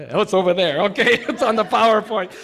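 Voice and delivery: fast, high laughing aside. Real, not acted. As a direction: laugh it off, quickly. Finds slide, laughs.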